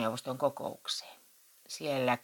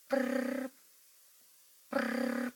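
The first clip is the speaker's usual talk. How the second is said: high rolled prrr of a ringing phone; imitated phone ring; 10 semitones higher than usual. A narrator's reading. Narrator mimics Matti's phone ringing, high trilled prrr. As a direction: trill like an old telephone.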